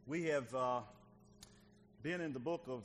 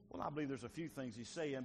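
ordinary delivery

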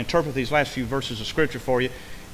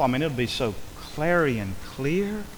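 ordinary talk

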